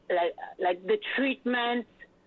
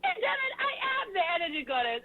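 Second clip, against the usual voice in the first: high, quick, excited self-declaration; acted proud realization; 11 semitones higher, faster than usual. Host retelling how she embraced her nickname, excitedly proud. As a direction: play up owning the title, pitched high.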